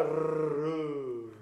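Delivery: ルルル as a trilled rolled r. Shown as a demonstration. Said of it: "巻き舌できる方は".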